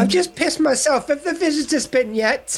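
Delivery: put-on high-pitched comic old codger; mock old man. Friend topping the care-home joke, high put-on old voice.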